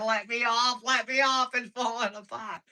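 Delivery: high-pitched imitated pleading; mock panic. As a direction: mimic a panicking child, short of a full scream. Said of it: "she's over there panicking".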